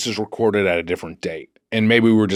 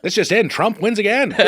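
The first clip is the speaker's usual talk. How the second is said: newsreel announcer; 9 semitones higher than usual. Acted. Mock news bulletin.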